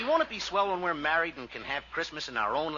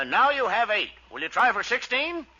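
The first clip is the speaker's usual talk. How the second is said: [name] impression; 5 semitones higher, 5 dB louder than usual. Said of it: "Who does that sound like?".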